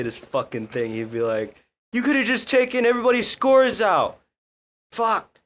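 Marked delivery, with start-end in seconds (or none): 1.9-5.4 high, loud drill sergeant berating the squad; Sergeant Jimenez. Retold or imitated. Sergeant blames a trainee before everybody, mock angry shouting. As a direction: bark it out as a sarcastic group scolding. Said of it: "Sergeant Jimenez goes, he goes".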